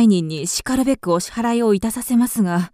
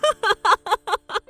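high, mocking peal of laughter; derisive laugh; 9 semitones higher than usual. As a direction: laugh his claim away. Widow laughs at his claim.

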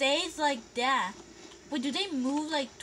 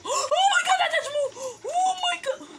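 very high, loud frightened shriek; loud scream with a nervous laugh; scared laughing scream; 13 semitones higher, 6 dB louder than usual. Real, not acted. Enemy suddenly moved at her.

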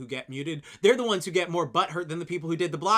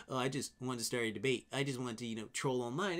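low, softer mock voice; mocking troll imitation; 5 semitones lower, 8 dB quieter than usual. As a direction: play the troll low and offhand. Retold online troll, dismissive imitation.

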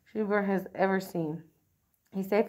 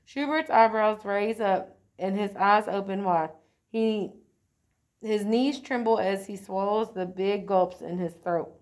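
ordinary delivery, nothing marked

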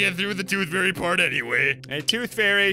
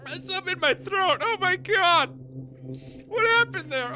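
surprised squeak. Real, not acted. Startled by a glitch in her throat.

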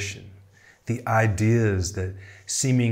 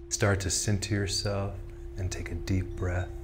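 guided meditation; 4 dB quieter than usual. Acted. Tarot guide leading listeners into breathing and imagery, calm guided meditation. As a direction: ease them gently down into stillness.

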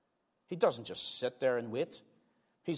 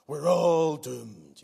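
loud, drawn-out Scottish wail of doom; Private Fraser; 8 dB louder, much slower than usual. Retold or imitated. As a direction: proclaim it slowly and loudly in a mock Scottish accent. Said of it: "He said it with a Scottish accent".

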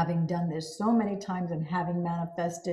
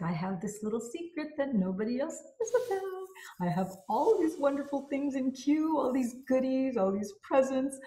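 secretive giddy glee; 6 semitones higher than usual. Shown as a demonstration. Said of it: "it's like".

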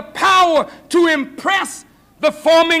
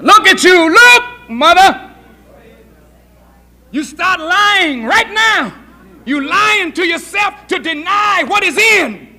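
forceful preaching; 4 semitones higher, 4 dB louder than usual. Real, not acted. Preacher rebuking the mother for lying to herself, forceful preaching. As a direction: confront the listener, hitting the words hard.

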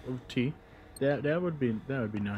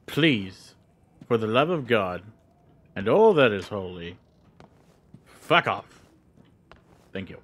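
pleading louder and louder to a shout; building stern plea; 6 dB louder than usual. Retold or imitated. Player begging enemies to leave, building to a shout. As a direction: build from firm to yelling. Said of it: "give them a stern-voiced".

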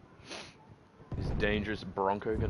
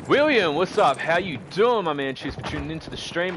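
high, loud streamer welcome; hyped greeting; 7 semitones higher, 6 dB louder than usual. Real, not acted. Streamer welcomes William to the stream, hyped and loud. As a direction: greet a viewer with a burst of energy.

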